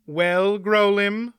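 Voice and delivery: defiant taunt; challenging. Acted. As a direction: dare him to try. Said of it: "Aunt Pole challenged".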